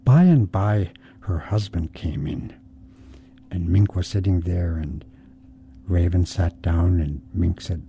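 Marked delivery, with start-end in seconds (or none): none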